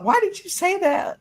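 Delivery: high, incredulous demand; retold shock at mom's joke, voice jumping high; stunned question. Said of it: "I thought".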